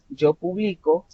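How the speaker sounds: "publico" stressed on BLI; stress on middle syllable. Shown as a demonstration. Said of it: "ya no empieza".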